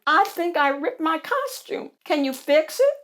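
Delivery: high, worried character squeak; squeaky voice. A narrator's reading. Bonnie asking for a costume repair, squeaky voice. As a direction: squeak out a plea for help. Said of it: "squeaked Bonnie Beverly".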